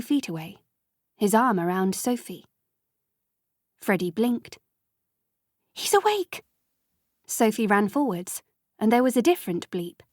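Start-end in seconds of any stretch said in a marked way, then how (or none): 5.7-6.4 high, excited exclamation; delighted shout of relief; joyful cry. A narrator's reading. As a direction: burst out in relief. Freddy opens his eyes.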